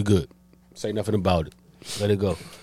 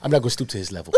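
put-on lightly done African accent; 5 semitones lower, 6 dB quieter, faster than usual. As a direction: imitate the African man's accent.

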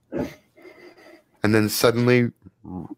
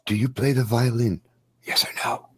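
hushed, confiding question; soft near-whisper, fading to fully whispered; 4 dB quieter than usual. Acted. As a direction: lean in and ask under your breath. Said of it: "she whispers to you".

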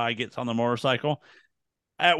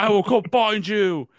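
raised, hammed-up dramatic quote; mock movie hero; 6 semitones higher, 3 dB louder than usual. Host echoes co-host's film parody, mock-heroic cry. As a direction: echo the melodrama in a higher voice.

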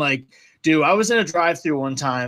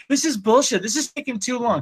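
retold yelling; 8 semitones higher than usual. Friend's girlfriend complaining at the drive-thru waitress, mock yelling. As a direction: imitate an irate customer's high-pitched complaint. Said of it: "just yelling at the girl, like, screaming".

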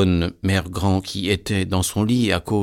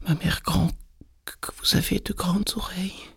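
put-on very high, thin-whispery child voice; astonished little girl; 11 semitones higher, 4 dB quieter than usual. A narrator's reading. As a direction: marvel in a tiny high voice. Girl's mounting astonishment.

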